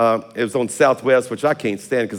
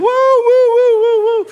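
much higher, louder imitated party whoops; tipsy woo-hoo; 8 dB louder, much slower than usual. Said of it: "She came around the corner just woo".

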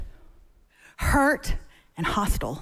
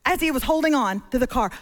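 normal talking voice

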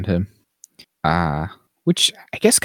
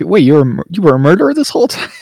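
much higher, louder incredulous parent; mock alarm; 9 semitones higher, 7 dB louder, faster than usual. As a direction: ham up the horrified parents. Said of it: "They're like".

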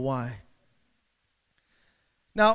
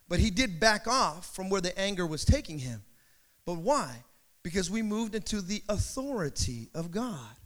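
normal talking voice

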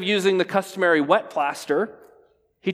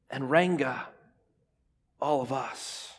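hushed; 3 semitones lower, 7 dB quieter than usual. Acted. Hushed sermon refrain.

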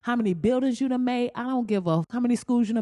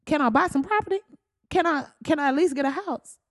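pleading; 6 semitones higher, faster than usual. Pretend. Plays herself begging for property.

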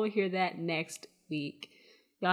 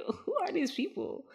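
amused bewilderment; 10 semitones higher than usual. Retold or imitated. Repeats bewildered question amid laughter.

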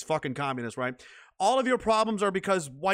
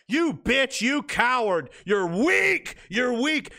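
higher, louder scornful outburst; angry berating; 7 semitones higher, 4 dB louder, much slower than usual. Real, not acted. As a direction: lash out at a coward. Berating the cowards he imitated.

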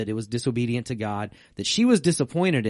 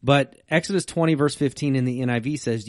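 usual speaking voice

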